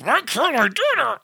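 high confused half-asleep whine; groggy frustrated wife. Retold or imitated. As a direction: play her baffled, stuck in a onesie. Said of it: "You would wake up like".

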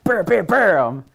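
loud, high mock sword strikes, each bam slammed out. Fan imitating Geralt's heavy stance, loud, high bams. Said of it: "as this heavy attack".

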